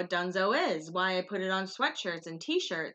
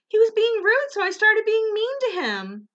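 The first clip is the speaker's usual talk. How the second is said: much higher, louder indignant voice; imitating Kristen; 13 semitones higher, 8 dB louder than usual. Kristen explaining why she was mean to the cop, high indignant. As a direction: say it high and loud, righteous. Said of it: "she goes".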